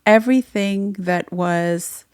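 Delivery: usual speaking voice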